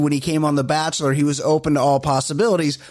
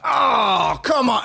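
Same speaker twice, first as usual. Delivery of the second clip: imitating the guys' groan; 5 semitones higher, much slower than usual. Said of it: "all the other guys are like".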